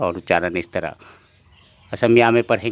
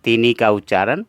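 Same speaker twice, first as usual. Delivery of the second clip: ordinary talk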